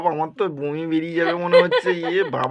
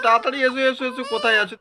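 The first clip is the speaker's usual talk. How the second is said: urgent, higher call; raised hurry-up call; 7 semitones higher, faster than usual. Acted. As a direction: shout for her to hurry. Calling wife to come quickly.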